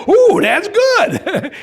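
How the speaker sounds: high, very slow laughing ooh; delighted ooh. Retold or imitated. Salesman hears of a big house, high and delighted. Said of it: "I said".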